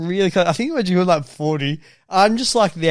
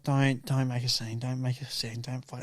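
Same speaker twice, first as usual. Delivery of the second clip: soft, low, fading plea; pleading under breath; 5 semitones lower, 11 dB quieter than usual. Retold or imitated. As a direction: beg them quietly not to cause a scene. Driver begging rowdy passengers.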